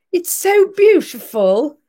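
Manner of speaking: high, slow, lightly done Australian accent imitating Olivia; a fond, slightly hammed-up Australian accent, high-pitched. Olivia thanking mother for the drawing, mock Australian accent. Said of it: "with her Australian accent".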